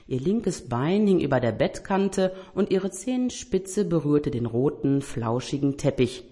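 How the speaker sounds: ordinary delivery, nothing marked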